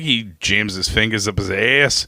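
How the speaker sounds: ordinary talk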